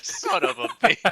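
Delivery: high, drawn-out curse through laughter; mock exasperation. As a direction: curse in laughing disbelief, stretched out. Laughing exasperated quote of own reaction.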